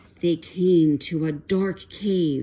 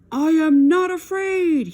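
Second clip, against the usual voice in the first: high, loud shout of false courage; 11 semitones higher, 8 dB louder than usual. A narrator's reading. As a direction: shout to convince yourself. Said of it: "he shouted".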